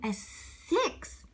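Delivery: usual speaking voice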